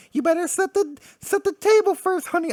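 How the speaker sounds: high falsetto mom, sweet and chiding; mock mom voice. Cable's mom telling him to set the table, high falsetto mock mom. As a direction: squeak it up like a doting mom.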